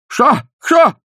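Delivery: high, loud panicked cries; old man shouting when seized; startled shout. A narrator's reading. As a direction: shout in alarm at being grabbed. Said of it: "закричал беспокойный старик".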